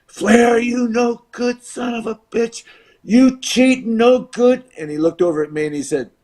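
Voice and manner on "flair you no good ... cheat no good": acted heckling on a high, sustained pitch; imitated angry heckler. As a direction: yell abuse like an outraged fan. Crowd jeers at Flair.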